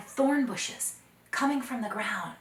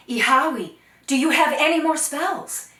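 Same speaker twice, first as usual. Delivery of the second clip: urgent question; 7 semitones higher, 14 dB louder than usual. Acted. Dakota asks urgently.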